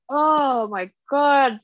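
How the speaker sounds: slow, high, drawn-out dismay; slow, high, disbelieving sigh of shock; stunned. Retold or imitated. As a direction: let it sink in slowly. Reacting to daughter's death.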